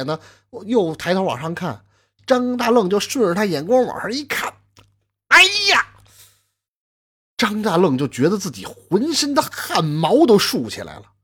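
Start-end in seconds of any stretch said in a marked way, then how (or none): 5.3-5.9 much higher, louder shocked outcry; horrified cry. Acted. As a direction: scream of horror at the sight. Father sees his hanged wife. (惊恐的大叫)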